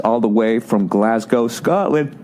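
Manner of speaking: words stretched through a yawn. Real, not acted. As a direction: read it mid-yawn. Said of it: "Sorry, I'm yawning".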